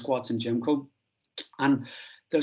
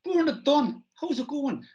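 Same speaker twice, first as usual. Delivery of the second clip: high-pitched put-on Dublin accent; Bernard Dunn; 14 semitones higher than usual. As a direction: imitate a Dubliner introducing himself, pitched way up. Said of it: "That's my Dublin accent".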